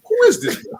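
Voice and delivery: very high, disbelieving retold cry; baffled high-pitched outburst; incredulous mock shout. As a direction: squeal it in mock bewilderment. Retold baffled reaction, cracking up.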